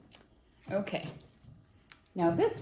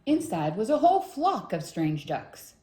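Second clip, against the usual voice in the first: plain reading voice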